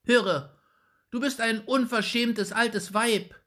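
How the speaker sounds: put-on high, loud, cross boy's voice; a small boy telling off an old woman; indignant boy. A narrator's reading. Young Jakob scolding the rude old woman, loud and high. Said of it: "rief er unmutig".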